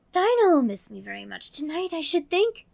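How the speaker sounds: put-on high, bright little girl voice; Alice voice. Narrator voicing Alice, girlish and eager. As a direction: play young Alice talking to herself.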